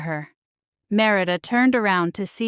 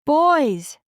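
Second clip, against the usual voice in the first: put-on higher, louder call to the boys; mother calling her climbing sons; sharp call; 6 semitones higher, 4 dB louder than usual. A narrator's reading. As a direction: call out in alarm. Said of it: "Eleanor called".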